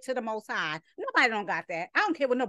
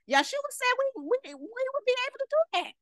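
incredulous; 11 semitones higher than usual. Real, not acted. Preacher doubting anyone has such faith, incredulous. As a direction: voice climbs way up in disbelief.

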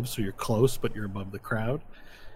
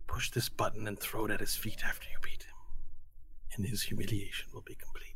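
conspiratorial whisper; 7 dB quieter than usual. Acted. Secret plan whispered by schemer.

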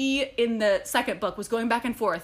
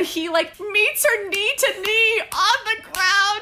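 excited squeal; 8 semitones higher, 4 dB louder, slower than usual. Real, not acted. Gushing over romantic book scene.

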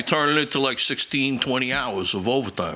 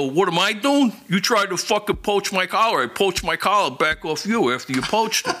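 higher and louder, heated snap back; angry comeback; 10 semitones higher, 3 dB louder than usual. Retold or imitated. Detective yells back at the sergeant, heated and half laughing. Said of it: "I go".

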